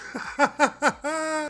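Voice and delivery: high, breathy laugh after the tease; real laugh. Real, not acted.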